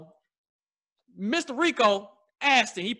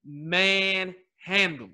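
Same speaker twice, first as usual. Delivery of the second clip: drawn out slowly; 4 semitones lower, much slower than usual. Real, not acted. Drawn-out echo.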